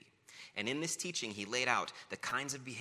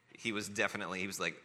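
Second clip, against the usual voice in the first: ordinary talk, nothing marked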